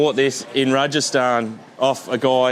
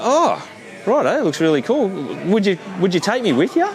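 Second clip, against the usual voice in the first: higher-pitched, lightly imitated eager customer; retold keen customers; 6 semitones higher than usual. Customers ask stallholder about joining his trip, imitated eagerness. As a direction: mimic an intrigued customer asking to come along. Retelling intrigued customers' request.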